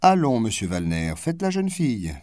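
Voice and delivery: high, playful teasing call; a ritual joke to the archivist. Acted. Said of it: "selon une plaisanterie consacrée".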